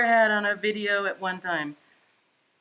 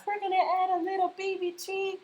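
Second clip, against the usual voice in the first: Bob Ross voice; 11 semitones higher than usual. Retold or imitated. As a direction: lightly imitate a calm TV painter. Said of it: "let's be, uh, Bob Ross".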